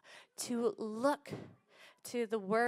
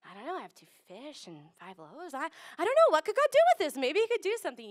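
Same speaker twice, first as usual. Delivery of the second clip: starts hushed then jumps high and loud; a little boy wondering aloud what God could do; a boy offering his lunch, growing eager; hopeful child; 4 semitones higher, 7 dB louder, faster than usual. Retold or imitated. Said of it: "imagine him being like".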